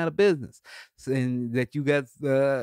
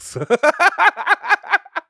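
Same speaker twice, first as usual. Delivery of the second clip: loud high burst of laughter; big laugh; 8 dB louder than usual. Real, not acted. Comedian laughing at his own festival boast, loud laugh. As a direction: laugh out at himself.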